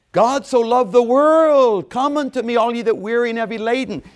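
fervent preaching. Acted. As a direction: sing out God's invitation. Quoting scripture with fervor.

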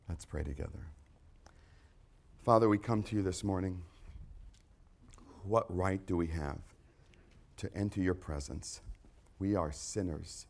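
closing congregational prayer. Acted. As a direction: pray it gently.

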